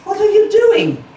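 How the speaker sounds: high, loud, outraged cry; indignant mother duck. A narrator's reading. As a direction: scold the drake for pecking. Mother duck scolds drake.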